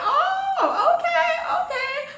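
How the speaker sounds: squeaky high laughing oh okay; high-pitched giggling disbelief. Retold or imitated. Retelling shock at the kiss.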